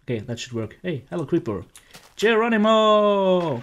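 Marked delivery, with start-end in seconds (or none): 2.2-3.6 long, high and loud yell; shouting. Real, not acted. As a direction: yell it as you leap. Shouting as he jumps down.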